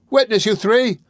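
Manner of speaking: put-on high, loud, excited cry from a boy. A narrator's reading. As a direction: call them to witness at full voice. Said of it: "cried the boy".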